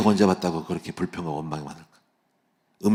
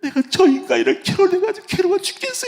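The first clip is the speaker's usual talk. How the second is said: much higher put-on complaining wife voice; imagined wife's lament; 3 dB louder than usual. Pastor voices what he feared his wife felt, high falsetto lament. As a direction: squeak out the wife's misery in a falsetto. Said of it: "하면서".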